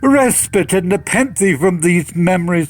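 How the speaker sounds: loud, rising, desperate plea; a raw cry of grief. Acted. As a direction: beg him to drink and forget. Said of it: "I cried".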